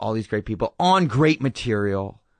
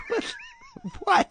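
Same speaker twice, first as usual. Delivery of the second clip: baffled disbelief; 3 dB quieter than usual. Retold or imitated. Baffled by Tommy's favourite scene.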